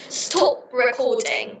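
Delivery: slow British-accented demo. Shown as a demonstration. Showing partner how British say it, slow British accent. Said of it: "In the British accent, we say".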